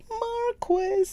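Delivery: Marquis said as MAR-kwi, high and laughing; Marquis, the "kwi" stretched out; British pronunciation. Shown as a demonstration. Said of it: "if we do British".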